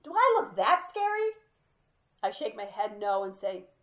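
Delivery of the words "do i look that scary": much higher and louder, half laughing; loud wounded question. Retold or imitated. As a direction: blurt it across the store. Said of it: "loud-ass voice".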